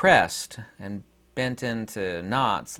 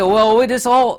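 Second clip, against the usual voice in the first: a hammed-up Australian accent, higher and louder; butchered Australian accent; 10 semitones higher, 9 dB louder than usual. Vlogger mimics his Sydney buddy in a butchered Australian accent. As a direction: play a bad Aussie mimic with a loud, lifted Australian accent. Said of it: "I'm gonna make an Australian accent that I'm gonna butcher".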